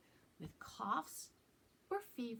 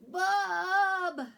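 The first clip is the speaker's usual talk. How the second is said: loud high call through a blocked nose; stuffed-up call; 11 semitones higher, 12 dB louder than usual. Acted. Sick boy calling mom, loud stuffed-up call. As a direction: shout for mom, nose clogged. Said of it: "not very right stuffed up voice".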